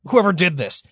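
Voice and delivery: lightly voiced angry Freddy; seething Freddy. Freddy mildly voiced swearing revenge on the killers. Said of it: "he's like".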